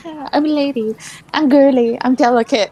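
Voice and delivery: a hint of a dainty lady voice; mock ladylike. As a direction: lightly play up the delicate lady. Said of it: "a very ladylike".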